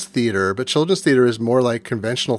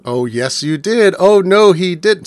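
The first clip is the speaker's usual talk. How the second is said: singsong back-and-forth call-out, growing louder; panto call-out; 5 semitones higher, 6 dB louder, slower than usual. Shown as a demonstration. Demonstrating panto call-outs for the others, singsong and loud. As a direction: chant it like a panto audience answering back, rising higher and louder. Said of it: "there'll be specific call-outs".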